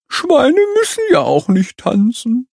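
high sulky grumble; grumbling. A narrator's reading. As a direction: grumble it huffily. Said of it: "grummelt der Strauß".

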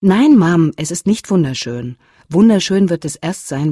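put-on irritated teen protest; angry protest. A narrator's reading. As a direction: snap back at his mother. Son loudly contradicts mother.

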